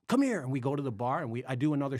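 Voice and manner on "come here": urgent summons. Retold or imitated. Girl summons him, acted quote.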